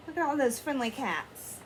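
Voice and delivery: usual speaking voice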